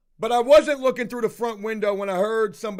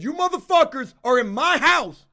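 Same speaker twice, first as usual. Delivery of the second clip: loud furious accusation, pitched higher; angry; 5 semitones higher, 6 dB louder than usual. Retold or imitated. Homeowner yelling at the worker for getting high, loud and furious. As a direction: yell the accusation in his face. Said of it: "I said".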